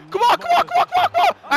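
high, rapid squealed pleading; high-pitched frantic repeats; frantic urging. Real, not acted. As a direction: urge the player on in a rising squeal. Urging on an attack.

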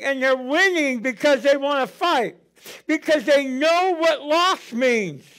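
full preacher mode, pushing the congregation; preaching. Acted. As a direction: build the sermon with mounting fervor. Preaching at rising force.

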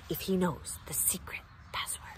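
soft, breathy conspiratorial whisper; secret whisper. Acted. Aunt hints at secret password.

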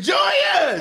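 high shouted name; screaming fans. Retold or imitated. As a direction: shriek it like a fan. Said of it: "they started screaming".

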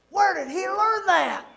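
high, loud feigned shock; hammed-up outraged question; mock indignation. Parent asking Amy about son's yell, mock indignation.

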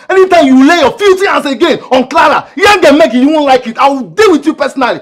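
very high, loud warning; angry shouted threat. Acted.

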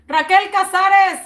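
ordinary talk

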